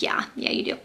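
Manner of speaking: soft unvoiced whisper, gently pushing; whispered insistence. God's inner voice insisting she tell her mom, in a whisper. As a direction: breathe it like a quiet inner voice. God answers her refusal, retold.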